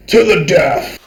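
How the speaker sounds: very loud game announcer; dramatic announcer. A narrator's reading. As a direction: boom like a fight game. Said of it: "A loud, dramatic voice".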